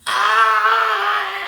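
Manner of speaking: high strangled ah of pain; boy hit by the sock lets out a cry; stifled pained cry. Retold or imitated. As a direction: let out a choked cry. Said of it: "He had, like, a silent cry".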